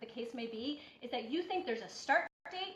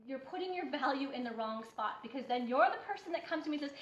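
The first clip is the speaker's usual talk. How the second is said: normal talking voice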